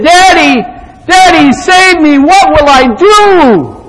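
high, loud cry for rescue; desperate yell. Acted. Trapped boy calling down to his daddy, desperate yell. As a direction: yell out in panic, stretching the last words. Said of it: "he yelled out".

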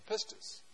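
pistis breathed out, PIS-tis. Shown as a demonstration. Pastor teaching the congregation the Greek word pistis, breathy. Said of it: "remember the word pistil, but put I S on the end".

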